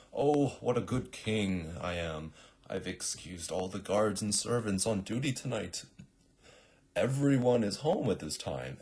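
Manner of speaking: lightly voiced pompous king monologue; self-satisfied king. King alone on balcony, lightly hammed-up self-congratulation. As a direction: announce it for any eavesdropper to hear. King's acted stagey monologue.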